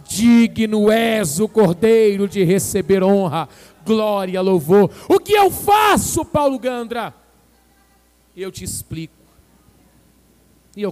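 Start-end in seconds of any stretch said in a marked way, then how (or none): 0.1-4.9 slow, loud, ringing proclamation; proclaimed worship. Retold or imitated. Anciãos gritam adoração. 5.1-7.1 high, loud preacher's cry; shouting. Real, not acted. Pregador grita no clímax.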